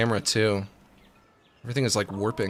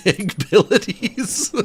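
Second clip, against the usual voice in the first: laughing; 6 semitones higher than usual. Real, not acted. Laughing through the words.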